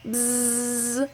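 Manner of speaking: buzzing like a bee. Acted. Teacher imitating the bees at the hive, buzzing. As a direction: make a little bee buzz.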